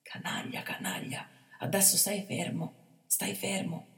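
soft whispered hush. A narrator's reading. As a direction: keep him still without a sound. Bandit hushing the boy.

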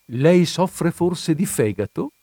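a slow arch question about the liver; sly question. A narrator's reading. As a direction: ask it with a wry, teasing edge. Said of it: "domandò poi argutamente".

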